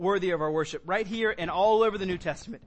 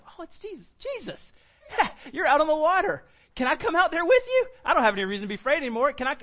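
high-pitched, eager burst, quickening at the end; Peter's childlike excitement; 8 semitones higher, 5 dB louder, faster than usual. Acted. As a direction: play a thrilled little kid begging to join in. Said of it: "It feels like a little child".

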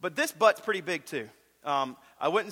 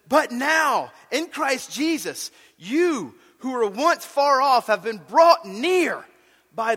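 preaching at full force; 5 semitones higher, 7 dB louder than usual. Acted.

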